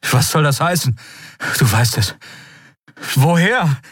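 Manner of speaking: high, disbelieving demand; agitated questioning. Acted. Keru demands how Endriel knows, agitated. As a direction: press for an answer.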